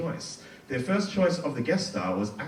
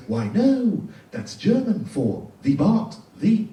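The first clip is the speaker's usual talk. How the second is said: high, slow, loud smug explanation; mock Sideshow Bob; 6 semitones higher, 7 dB louder, slower than usual. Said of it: "And he goes".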